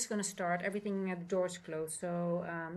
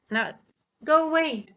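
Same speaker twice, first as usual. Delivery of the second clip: higher, louder shoo; 8 semitones higher, 9 dB louder than usual. Real, not acted. Owner shooing the cat off the laptop, higher and loud. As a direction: send the pet off firmly.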